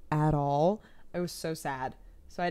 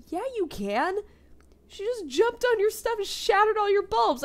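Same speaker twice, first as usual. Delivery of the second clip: mock Sam voice; 13 semitones higher than usual. Quoting Sam.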